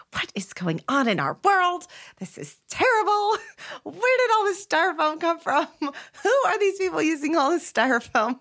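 high, dismayed disbelief; incredulous, laughing outburst; exasperated outcry. Retold or imitated. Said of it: "I was also like".

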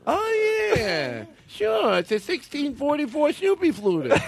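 high nasal car-buff voice; gushing car collector voice; Jay Leno impression. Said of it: "He's always got these cars".

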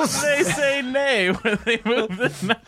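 slow words through laughter; laughing. Real, not acted. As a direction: laugh out the punchline. Laughing while telling the joke.

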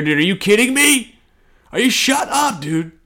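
incredulous outburst. Real, not acted. Ranting to listeners about the mayor, high incredulous disbelief. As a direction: pitch jumps way up in disbelief.

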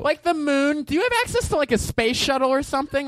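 put-on high squeaky girly request; mock girl voice. Co-host playing the date, asking in a high squeaky voice. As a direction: ask for the moon like a spoiled date.

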